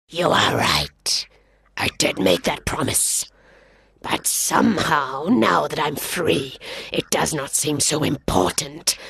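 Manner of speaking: a big cat shrugging off his promise, low; hungry lion. Acted. Lion to the boy, low and unbothered.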